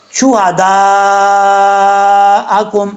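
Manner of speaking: شہداءکم with a heavy ha and the madd stretched long; drawn-out recitation of شہداءکم; slow tajweed demo. Shown as a demonstration. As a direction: stretch شہداءکم slowly, madd held five counts. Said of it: "ہا بھاری sound کے ساتھ".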